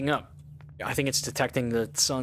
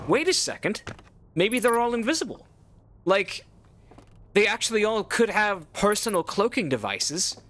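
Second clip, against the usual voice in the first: high and louder, a sudden excited hunch; excited realization; 6 semitones higher, 3 dB louder than usual. Real, not acted. Player to viewers, spinning a theory of invisible aliens: excited, high. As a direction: caught up in a wild new idea.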